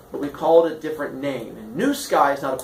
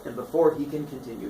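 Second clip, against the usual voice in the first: ordinary delivery, nothing marked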